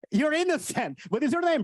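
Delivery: normal talking voice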